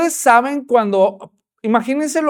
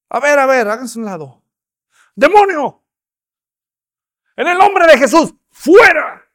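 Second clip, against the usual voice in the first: much higher and louder command; preacher's mock exorcism shout; commanding exorcist; 6 semitones higher, 7 dB louder than usual. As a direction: cast the demon out with full authority. Apostle commanding demon.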